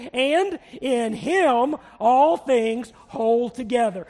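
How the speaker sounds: slow, measured, emphatic recitation; leading group reading. Shown as a demonstration. Preacher reads the underlined verse with congregation, leading group reading. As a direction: lead everyone word by word, slowly. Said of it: "let's read this underlined together".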